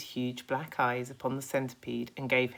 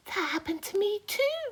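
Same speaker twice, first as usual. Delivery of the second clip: higher ladybird voice; lightly voiced ladybird. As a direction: chime in brightly.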